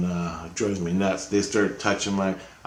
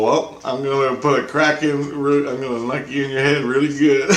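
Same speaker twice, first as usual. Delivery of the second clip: raised, feisty comeback; playful boast tipping into a laugh; mock threat; 5 semitones higher, 5 dB louder than usual. Answering Christ's challenge, playful mock threat.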